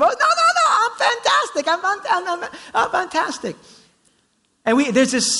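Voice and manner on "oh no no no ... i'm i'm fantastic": very high, laughing mock denial; proud refusal of help. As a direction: brush off help in a squeaky, laughing falsetto.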